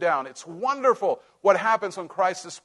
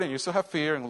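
ordinary talk, nothing marked

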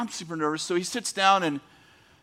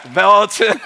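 loud laughing quote; 4 semitones higher, 10 dB louder than usual. Retold or imitated. Reading governor's text reply.

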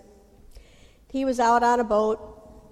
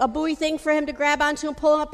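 usual speaking voice

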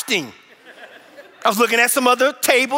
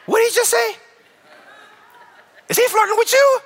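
much higher, incredulous squeak; outraged young husband, pitched way up; jealous disbelief. Retold or imitated.

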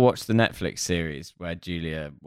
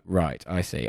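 ordinary talk

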